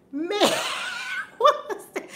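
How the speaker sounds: high, laughing question. Real, not acted. Laughing at the memory.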